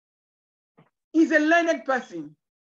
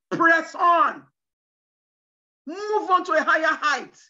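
shouted exhortation; 3 dB louder than usual. Real, not acted. Preacher exhorts congregation loudly.

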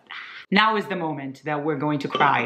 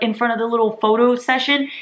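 ordinary talk, nothing marked

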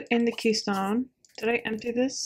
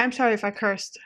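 usual speaking voice

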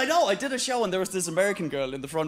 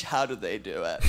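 put-on awed American girl; 11 semitones lower than usual. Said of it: "looks at the fish".

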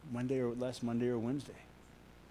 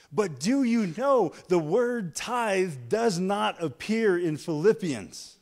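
loud, higher preaching build; full-force preaching; 7 semitones higher, 8 dB louder than usual. Acted. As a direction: drive it home to the congregation.

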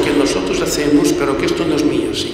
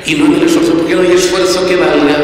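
normal talking voice

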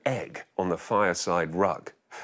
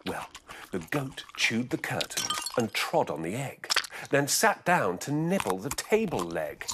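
plain reading voice

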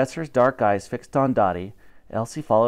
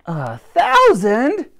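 much higher, louder, drawn-out cry of disbelief; shocked high squeal; 11 semitones higher, 7 dB louder, much slower than usual. Acted. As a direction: gasp it out in disbelief. Dottie shocked by number.

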